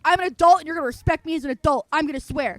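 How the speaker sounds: fast, loud, insistent; firm adult declaration. Retold or imitated. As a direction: lay down the law to your mother. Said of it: "I said it very much like".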